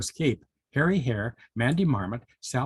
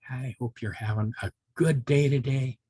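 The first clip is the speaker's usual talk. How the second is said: ordinary delivery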